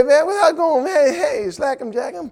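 high put-on slang voice, fading; mock street talk. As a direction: mimic a poser.

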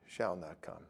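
a hushed, dawning "Shall not come"; softly repeating "Shall not come" as she realises; quiet answer. Retold or imitated. Her quiet reply, repeating.

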